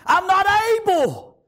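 sudden high cry of helplessness. Acted. Preacher admitting his weakness to God, high cry. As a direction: burst upward out of the hushed prayer. Cries out inability in prayer.

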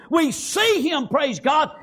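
ordinary delivery

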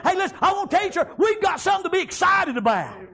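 high, ringing revival preaching; preacher at full throttle, calling out to the church; full-force preaching. Real, not acted.

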